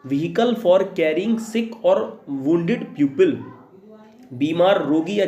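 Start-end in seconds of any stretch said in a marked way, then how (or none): 0.1-3.3 each word spaced out slowly for students to write; a teacher reading a definition aloud to be copied down, slowly; slow dictation. Shown as a demonstration.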